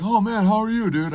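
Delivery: high, eager mock voice; mock friendly greeting. Libertarian greeting his friend, mocked high voice. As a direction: greet him in a put-on high voice.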